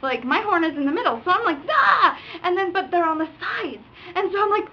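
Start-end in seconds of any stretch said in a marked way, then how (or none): 1.7-2.2 short loud high "ah"; sudden retold panic cry; acted frustrated yell. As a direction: yelp it while mock-pounding the horn. Acts hitting the horn in panic.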